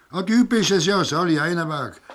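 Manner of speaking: high, loud surprised hail; surprised greeting. Acted. Policeman recognizes his old friend by the river and greets him with a loud surprised hail. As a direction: call out to him in sudden recognition.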